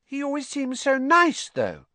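high-pitched, doubtful schoolgirl; uncertain. A narrator's reading. Hannah doubting the charge against Potter, uncertain. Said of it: "said Hannah uncertainly".

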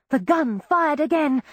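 usual speaking voice

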